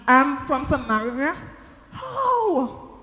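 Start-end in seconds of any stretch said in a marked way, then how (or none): 1.9-2.9 high, drawn-out wondering question; baffled. Acted. Woman cannot grasp how he knew her, baffled. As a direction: stretch it out in disbelief.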